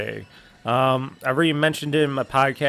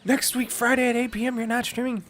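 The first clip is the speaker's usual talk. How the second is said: high, incredulous imitated viewer; surprised viewer; 10 semitones higher than usual. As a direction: gasp it out as a shocked fan.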